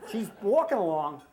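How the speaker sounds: normal talking voice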